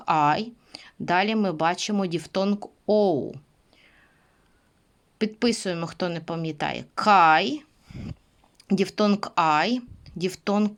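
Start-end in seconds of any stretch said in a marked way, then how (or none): none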